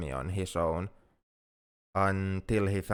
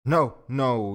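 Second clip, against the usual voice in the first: louder, higher hurried denial; urgent protest; 4 semitones higher, 7 dB louder than usual. Acted. Alien reassuring worried listener they did nothing, urgent protest. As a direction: cut in to reject their blame. Hastily refusing the other's apology.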